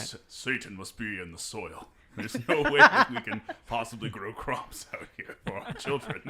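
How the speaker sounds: loud Puritan father, preaching; old-timey patriarch voice. Pretend. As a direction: bellow like a stern Puritan patriarch. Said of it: "old-timey patriarch voice".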